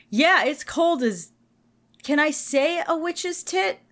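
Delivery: high, slow and coy; playful mock innocence; cheeky. Real, not acted. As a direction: tease the naughty word out. Jokey crude phrase, cheeky.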